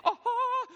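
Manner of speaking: very high, loud stunned "aha"; hammed-up astonished outburst; deer-in-headlights gasp. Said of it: "like a deer in the headlight".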